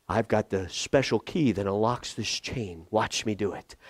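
a hint of a know-it-all helper; lightly voiced self-assured helper. Voicing people offering a special key.

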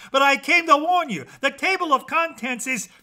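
high, loud, frantic warning; urgent warning. A narrator's reading. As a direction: try to get a word in before being cut off. Jack urgently warns the characters.

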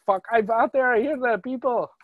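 half-hearted, high-pitched attempt at an accent; GSP. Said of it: "Fuck this G S P accent".

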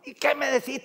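high, insistent mock scolding; reto imitado de mamá. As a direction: press for a reply as a nagging wife. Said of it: "Ella grita". (reto fingido, agudo e insistente)

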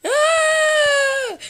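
high loud startled scream. Real, not acted. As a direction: shriek out in fright. Sudden scream at game.

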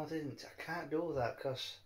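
ordinary delivery

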